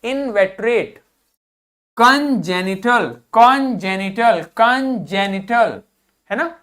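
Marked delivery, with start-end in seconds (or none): 2.0-5.7 congenital stretched out very slowly; very slow model pronunciation. Shown as a demonstration. Teacher slowly drills congenital for the class. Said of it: "इसी प्रकार इसको पढ़ेंगे".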